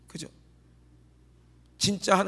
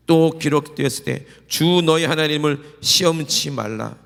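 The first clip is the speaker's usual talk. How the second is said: slow, loud, weighty proclamation; solemn scripture quote; 8 dB louder, slower than usual. Acted. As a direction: give each word of the verse full weight. Quoting Jesus with solemn weight. (천천히 힘주어 선포하는 성경 말씀)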